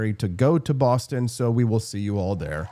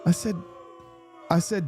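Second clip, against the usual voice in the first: ordinary talk, nothing marked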